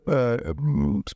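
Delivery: usual speaking voice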